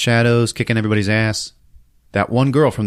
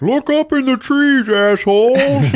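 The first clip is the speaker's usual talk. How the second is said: high, loud howled words; barking dog; 5 dB louder, slower than usual. Acted. Dog warning the men below the trees, high loud bark-talk. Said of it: "a dog starts barking like".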